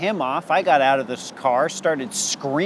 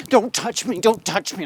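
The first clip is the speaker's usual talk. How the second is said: frantic yell; 6 semitones higher than usual. Retold or imitated. Fights off cop.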